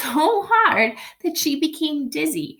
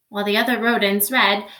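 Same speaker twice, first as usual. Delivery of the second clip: ordinary delivery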